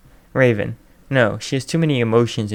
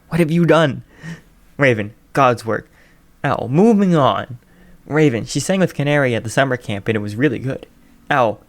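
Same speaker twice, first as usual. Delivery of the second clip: ordinary delivery, nothing marked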